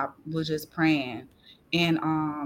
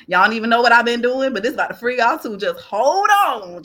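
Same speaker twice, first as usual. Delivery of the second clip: urgent; 3 semitones higher, 7 dB louder than usual. Retold or imitated. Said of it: "I was like".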